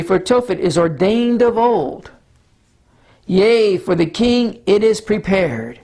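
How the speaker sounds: slow, solemn Bible reading; scripture reading. Acted. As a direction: read it as sacred text. Reads Isaiah aloud.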